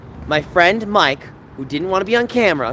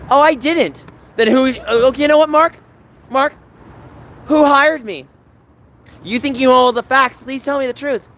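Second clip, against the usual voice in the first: heated; 7 semitones higher, 4 dB louder than usual. Real, not acted. Heated argument with Mark.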